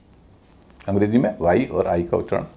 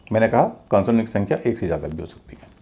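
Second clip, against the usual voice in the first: ordinary talk, nothing marked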